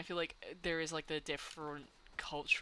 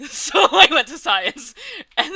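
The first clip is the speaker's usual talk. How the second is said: laughing; 10 semitones higher, 19 dB louder, slower than usual. Real, not acted. Laughing at her forced path.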